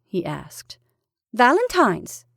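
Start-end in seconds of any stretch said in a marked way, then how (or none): 1.3-2.3 brisk, higher and louder. A narrator's reading. Wife showing off her post to husband, brisk. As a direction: announce it briskly, pitched up. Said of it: "she said briskly".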